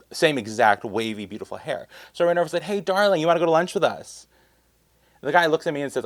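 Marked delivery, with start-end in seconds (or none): 2.6-4.3 cheerful call across the way, pitched higher and louder. Retold or imitated. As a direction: call her over brightly from a distance. Calling out to supposed friend.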